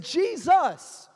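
loud high cry of Jesus; cried out. Acted. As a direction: call out desperately to Jesus. Bartimaeus crying out.

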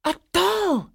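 put-on very high, loud girlish squeal; excited cry. A narrator's reading. Girl opening her present, excited cry. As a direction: squeal it out in delight. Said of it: "cried one girl".

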